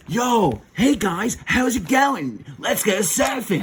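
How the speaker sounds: mock California surfer talk; California accent. New Ranger greeting the team, put-on California accent. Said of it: "He talks more of the California accent".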